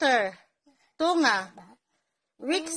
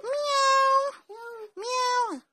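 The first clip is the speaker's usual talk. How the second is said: high, mewing kitty sound; mother imitating a cat; cat meow; 11 semitones higher than usual. Shown as a demonstration. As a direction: meow like a little cat for the child. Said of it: "cat sound demo".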